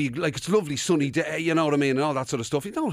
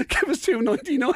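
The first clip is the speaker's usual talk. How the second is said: high mocking jibe; ordering a cone from the man in cream; ice-cream order jibe; 8 semitones higher than usual. Workmate mocking the cream suit, high jibe. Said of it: "came up to me and went".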